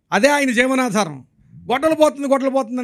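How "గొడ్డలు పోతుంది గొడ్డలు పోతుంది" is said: higher, louder mock yell; retold loud cry. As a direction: re-enact his repeated cry for help. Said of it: "గట్టిగా అరుస్తుంటే".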